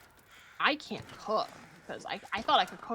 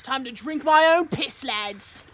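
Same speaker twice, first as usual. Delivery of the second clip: louder, hammed-up British accent; mock British accent; 3 semitones higher, 9 dB louder, slower than usual. Streamer riffing on Bear Grylls in a jokey British accent. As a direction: play a blokey British survivalist, Bear Grylls style British accent. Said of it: "I don't know why I became British".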